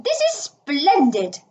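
high, slow, relishing exclamation; thrilled welcome; delighted greeting. A narrator's reading. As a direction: draw it out with delight. Toad delightedly greets his visitors.

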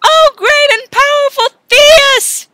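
slow, high, booming worship; grand invocation. Acted. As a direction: hail him like a god.

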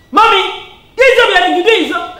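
loud, high scolding demand; child rudely confronting mother, loud and high; wrong way, loud. Shown as a demonstration. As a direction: shout it at the parents. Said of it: "ah, no, that's sin".

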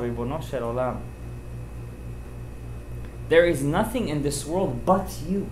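hushed prayer. Retold or imitated. Recalls his private prayer.

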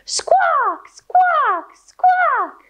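very high squawking parrot; sharp high parrot squawk. Pretend. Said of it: "let me hear you squawk".

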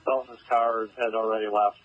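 crackly radio transmission; clipped call over a police radio; terse radio update. Real, not acted. Field unit over police radio.

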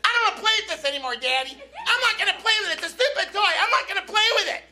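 high, loud, fast bratty whine; imitated whining child. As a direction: throw a mock kid's tantrum. Imitates daughter refusing the toy.

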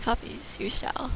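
whispered aside; whispering. Real, not acted. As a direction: say it under your breath.

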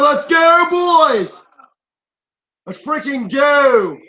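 loud hype shout; excited cheering. Real, not acted. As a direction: cheer the team on.

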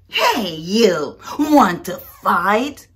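loud, slow drawn-out dare; the grouchy ladybug dares the yellow jacket; challenging shout. Acted. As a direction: bark the challenge at a bigger bug.

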